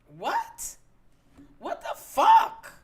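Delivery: shrill, high, drawn-out what the fuck; outraged teen squawk, stretched slow; indignant disbelief. Retold or imitated. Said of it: "her own indignant reply".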